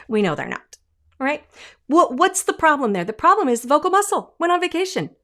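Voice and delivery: ordinary delivery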